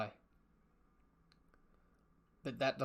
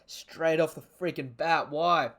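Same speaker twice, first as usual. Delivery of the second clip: loud, raised-pitch exasperated protest; upset outburst; 4 semitones higher, 10 dB louder, slower than usual. Real, not acted. Reader protesting a sad chapter, loud dismay. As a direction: protest in dismay, hitting the last word hard.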